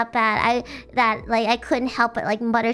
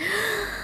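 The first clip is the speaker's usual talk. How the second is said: sharp gasp; 8 semitones higher, 6 dB quieter than usual. Retold or imitated. Remembering embarrassment at night.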